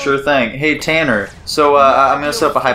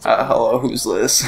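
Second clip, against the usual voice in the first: low-key creaky phone hello; deep masculine friend impression; 3 dB quieter than usual. Redoing Tanner's phone answer on request, deep and fried. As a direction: answer with a lazy vocal fry. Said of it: "my deep masculine voice and my vocal fry".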